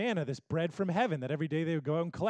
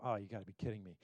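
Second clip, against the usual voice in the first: exasperated; 9 semitones lower, 11 dB quieter, faster than usual. Retold or imitated. Listener exasperated at grumbling Israelites.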